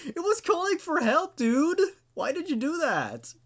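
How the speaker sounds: stunned it just got smashed; incredulous outburst. Real, not acted. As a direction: react in shocked disbelief at the narrator. Reader reacts to the character's choice.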